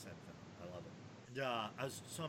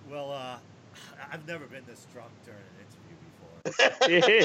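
laughing; 16 dB louder than usual. Real, not acted. Guest admits being drunk laughing.

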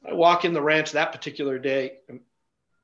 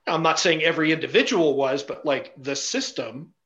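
ordinary delivery

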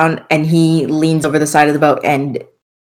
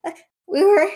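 high, pleading, frightened voice; terrified horror victim; 11 semitones higher, 5 dB quieter than usual. Acted. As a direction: beg for your life, squeaky with fear. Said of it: "he kneels down and he's like".

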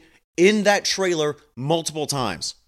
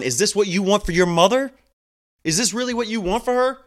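higher and louder, pressing hard; raised confrontation; 6 semitones higher, 4 dB louder, faster than usual. Retold or imitated. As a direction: demand an answer, voice climbing high. Confronting the son in trailer.

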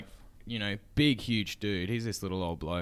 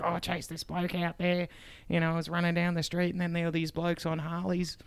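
lightly voiced old bloke, higher; a hint of the old manager spinning a yarn; old manager telling a yarn; 8 semitones higher than usual. Retelling the old manager's chase story, slightly higher voice. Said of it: "He's like".

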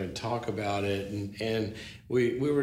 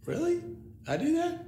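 much higher, puzzled disbelief; incredulous retold question. Storyteller replays his reply to a colleague's complaint, high-pitched incredulity. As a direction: act out being taken aback, voice jumping up.

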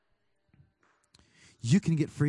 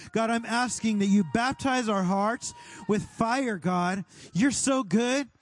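fervent prayer, declaring and thanking; 4 semitones higher than usual. Acted. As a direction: lift it up like a worship leader praying. Prays aloud over the room.

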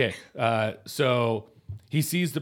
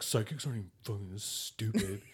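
salty; 2 semitones lower, 6 dB quieter than usual. Retold or imitated. Q grumbling about her psychic date, mildly salty. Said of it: "he's being real salty about it".

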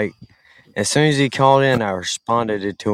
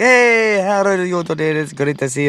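put-on mirrored Indian accent; 7 semitones higher than usual. Answering the caller in kind, put-on Indian accent. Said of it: "with the same accent he called in with".

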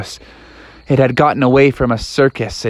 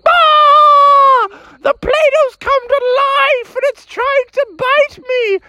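high-pitched hysterical frog shriek; terrified scream; 4 dB louder, slower than usual. Acted. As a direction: open on a loud scream, then wail it out high. Frog attacked, screams for help.

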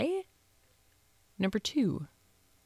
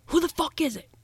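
higher, louder forced bravado; assertive shout; 11 semitones higher, 5 dB louder, faster than usual. Retold or imitated. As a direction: yell at the door to sound tough. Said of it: "trying to sound as assertive as he possibly can".

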